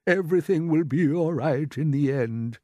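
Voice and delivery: calming grasshopper. A narrator's reading. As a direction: settle everyone down. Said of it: "keep perfectly calm".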